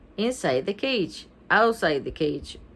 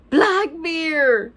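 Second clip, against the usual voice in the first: raised, high excited cry; mock scream; 8 semitones higher, 5 dB louder than usual. A narrator's reading. Judy and Stink find the parrot's name, mild scream. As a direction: burst out on the name, not quite screaming. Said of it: "both screamed at the same time".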